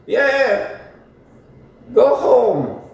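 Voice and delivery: high, slow mocking voice; mock her in a high drawn-out tone; high, slow mocking impression of a woman; act like Whitney. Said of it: "act like Whitney".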